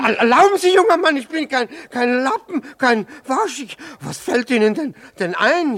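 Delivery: high, loud indignant sputter; outraged shouting protest; indignant outburst. Acted. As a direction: splutter with wounded pride, loud and high.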